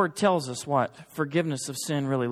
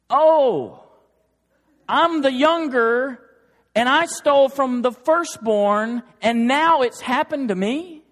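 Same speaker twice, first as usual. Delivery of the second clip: higher, louder, slowed dawning shock; Jacob's dawning realization; 8 semitones higher, 6 dB louder, slower than usual. Retold or imitated. Preacher voicing Jacob's epiphany for the church, higher, louder, slow shock. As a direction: let it hit you like Jacob finally seeing it. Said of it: "If you're Jacob".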